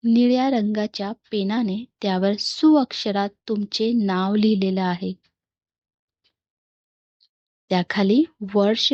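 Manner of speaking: measured meditation guide voice with long pauses; guided meditation. Real, not acted. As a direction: guide listeners into calm, phrase by phrase. (ध्यान करवणारा शांत, संथ आवाज)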